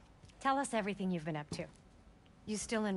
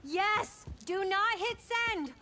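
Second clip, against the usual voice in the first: loud, slow, panicked warning; urgent shout; 10 semitones higher, 4 dB louder, much slower than usual. Real, not acted. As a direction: yell it to stop them. Urgently stopping a post.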